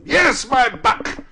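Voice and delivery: high, loud, drawn-out jovial call; hearty shout. A narrator's reading. Scrooge to the boy below, shouting heartily. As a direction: holler it with jolly affection.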